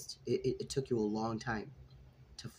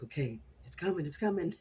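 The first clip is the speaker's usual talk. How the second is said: excited; 6 semitones higher than usual. Retold or imitated. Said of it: "I'm like".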